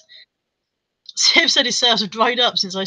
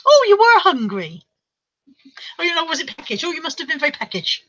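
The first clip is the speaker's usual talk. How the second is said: put-on comedy voice, pitched up; sketch character; 6 semitones higher than usual. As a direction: play a high, chatty sketch character. Acting out the Two Soups sketch.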